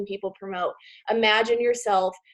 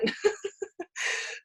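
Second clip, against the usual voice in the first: high, soft laugh; nervous laugh; 9 semitones higher, 6 dB quieter than usual. Real, not acted. Admitting why the chart is hidden, laughing. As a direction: let out a light, breathy laugh. Laughs at her own fear.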